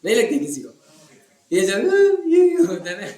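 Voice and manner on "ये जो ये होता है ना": high, odd cracked voice, proud of it; showing off hoarse voice. Shown as a demonstration. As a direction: show the throat's crack playfully. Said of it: "जब मेरा गला खराब होता है ना". (ऊँची, फटी सी आवाज़ दिखाते हुए)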